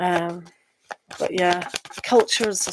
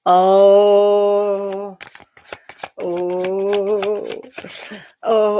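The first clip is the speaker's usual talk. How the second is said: hammed-up mock wail, louder; half-joking mock lament for the viewer; mock crying; 4 dB louder, much slower than usual. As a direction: pretend to sob over their news. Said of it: "I'm crying for you already".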